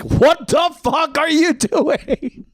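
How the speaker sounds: high, slow, drawn-out disbelief; imitating aghast friends. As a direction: stretch each word in mock outrage. Friends' shocked reaction, acted out.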